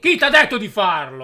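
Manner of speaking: sharp, loud demand from a stunned father; angry outburst. Real, not acted. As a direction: bark the question at your son. Dad discovers son's tattoo.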